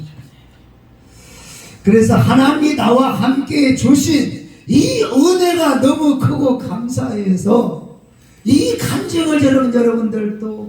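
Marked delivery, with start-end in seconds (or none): 1.8-10.7 fervent preaching. Acted. Preaching raised, slowed.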